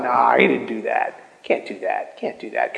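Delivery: low, hushed dismissive mutter; skeptical intellect. Retold or imitated. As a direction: mutter it down low as the doubting inner voice. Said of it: "the voice of your intellect".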